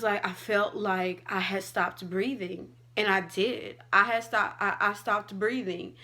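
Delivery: normal talking voice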